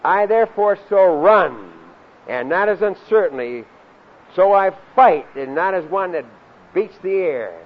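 loud scripture recitation with punched words; forceful preaching. Real, not acted. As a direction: proclaim the verse, leaning hard on key words.

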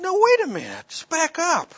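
loud, high-pitched interruption; alarmed protest. Retold or imitated. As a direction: cut in high and loud to halt him. Stunned father halts doctor's verdict.

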